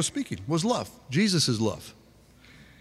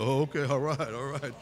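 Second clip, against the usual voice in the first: softly placating, trailing right down; hushed mock surrender; backing off; 6 dB quieter than usual. Preacher playing someone backing away from a shouting match, subdued.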